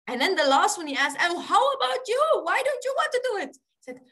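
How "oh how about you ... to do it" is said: very high, imploring question; insistent questioning. Retold or imitated. Director asking the last pujari to volunteer, insistent questioning. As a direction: press the last one, pitched high and quick.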